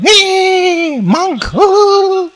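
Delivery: high squealing giggle; giggling women. Retold or imitated. Women on the street giggle at the monk, high squeal. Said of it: "The women start more to giggle".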